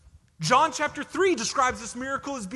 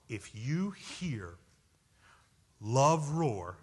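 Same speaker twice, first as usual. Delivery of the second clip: usual speaking voice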